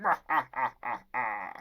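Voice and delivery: hammed-up evil cackle; spaced-out mock villain guffaw; villain laugh. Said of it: "And then he's like".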